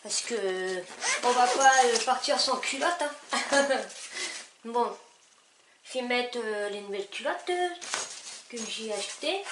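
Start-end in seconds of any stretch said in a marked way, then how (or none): none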